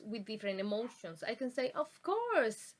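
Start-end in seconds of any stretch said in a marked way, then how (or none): 1.7-2.7 bright rise-and-fall, higher pitch, happy to oblige; cheerfully eager to help, pitched high. Shown as a demonstration. Speaker shows students intonation for happy to help, happy. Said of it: "like, I am happy to help".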